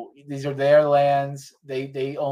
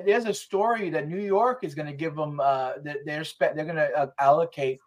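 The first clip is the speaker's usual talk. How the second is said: usual speaking voice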